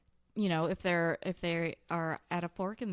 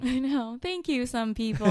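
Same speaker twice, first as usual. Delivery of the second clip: laughing; 4 semitones higher, 7 dB louder than usual. Real, not acted. Mock thanks to few listeners.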